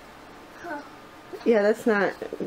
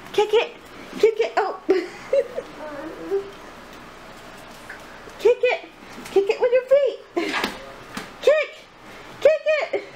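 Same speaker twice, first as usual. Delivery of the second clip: excited cheering; 9 semitones higher than usual. Real, not acted. Cheering baby to kick ball.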